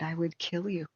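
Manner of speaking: a hushed killer's threat, staring him down; madman threat. Retold or imitated. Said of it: "the eyes of a madman and said".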